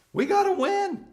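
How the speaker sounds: high, loud pep shout; rallying cry. Acted. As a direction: fire up the team. Team goal rallying cry.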